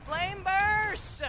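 long, rising spell shout; spell battle cry. Real, not acted. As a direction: yell out the attack as you fire it. Calling out a spell attack.

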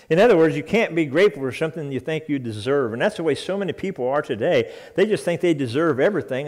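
normal talking voice

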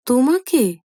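high, startled one-word question; astonished. A narrator's reading. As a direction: son caught off guard. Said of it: "এবার অবাক হবার ভূমিকা নির্মলের".

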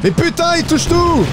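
high frustrated outcry; frustrated yell. Real, not acted. Frustrated at enemy while gaming.

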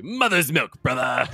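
very high, hammed-up wrestler line; shrill-free but very high mock wrestler; wrestler teddy. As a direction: squeal it like a cartoon wrestler. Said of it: "And he's like".

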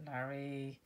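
ordinary delivery

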